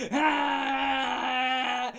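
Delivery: loud charging yell; mock roar. Charging the students with the bird, loud roar. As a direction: roar to scare the crowd.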